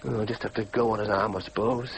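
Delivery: low, quick mumble to oneself; resigned musing. Acted. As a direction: give up glumly, pitch sunk. Alone, resigning himself.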